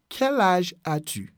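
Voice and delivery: "Quel âge as-tu" spaced word by word; measured teaching repeat. Shown as a demonstration. Teacher showing the question for someone close, measured teaching repeat. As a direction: ease through each word for learners.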